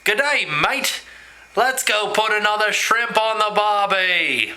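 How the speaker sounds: slow, drawn-out hammed-up Aussie accent; theatrical Australian accent. Shown as a demonstration. Vlogger shows off an accent for viewers, theatrical Australian. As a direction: ham up an Australian accent, stretching the words. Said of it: "it's a little bit theatrical".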